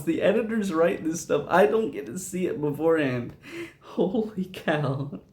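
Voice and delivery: can barely speak for laughing; laughing hard. Real, not acted. Telling viewers his real-time response while laughing hard. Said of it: "Got laughing so hard I got choked up".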